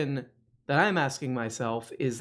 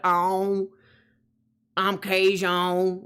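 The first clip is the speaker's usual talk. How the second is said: drawn-out, higher, nasal-ending character mimicry; Cajun Man; 5 semitones higher, 4 dB louder, much slower than usual. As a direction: imitate a comedy Cajun, stretching every word. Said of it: "so it's all like".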